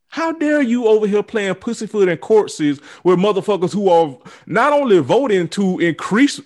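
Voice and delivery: angry rant. Real, not acted. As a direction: tear into them, voice rising. Furious rant at politicians.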